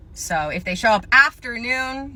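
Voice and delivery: ordinary talk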